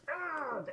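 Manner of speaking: high strangled cry of a dying man; a hammed-up dying yell; mock death scream. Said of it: "and he goes".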